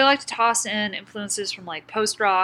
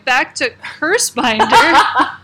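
laughing; 4 semitones higher, 9 dB louder, slower than usual. Real, not acted. Laughing over derailed tangent.

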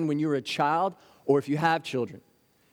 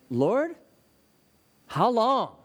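anguished cry to God; 7 semitones higher, much slower than usual. Acted. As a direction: lift it up like a lament to heaven. Crying out to God in grief.

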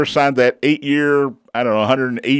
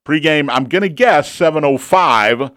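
normal talking voice

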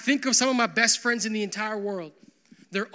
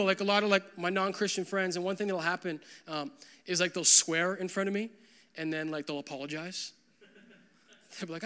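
normal talking voice

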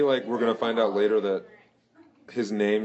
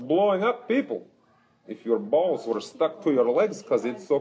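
put-on high, heavy Russian accent; 5 semitones higher than usual. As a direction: boast like a gruff Russian. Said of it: "your Russian accent".

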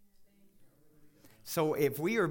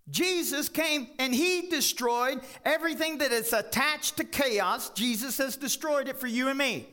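loud, high, rising preacher's cry; fired-up sermon peak; full-force preaching; 11 semitones higher, 5 dB louder than usual. Acted. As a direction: preach it out over the crowd.

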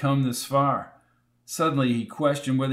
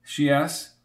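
ordinary delivery

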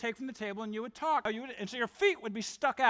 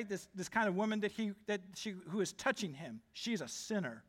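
muted disdain, dropping softer; condescending, understated; 2 semitones lower, 7 dB quieter than usual. Acted. Pharisee to himself about the woman touching Jesus, mildly condescending. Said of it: "In a condescending way".

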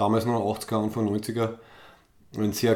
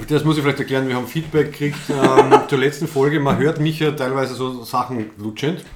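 laughing; 4 semitones higher, 8 dB louder than usual. Real, not acted. Explains while still laughing.

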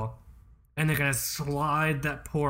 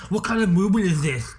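louder, higher mock nerd demanding an answer; watch nerd voice; 5 semitones higher, 6 dB louder than usual. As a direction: blurt it like a rude booth visitor. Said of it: "I love that voice".

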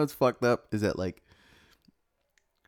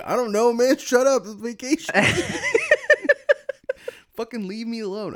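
annoyed snap; 13 semitones higher, 4 dB louder than usual. Retold or imitated.

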